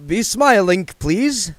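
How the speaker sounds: put-on eager, accented reassurance; Otto vampire accent. A narrator's reading. Otto promising William in an Eastern European accent. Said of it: "said Otto".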